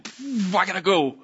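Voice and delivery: sudden urge to bolt; mock panic. Overwhelmed worshipper wanting out, in mock panic.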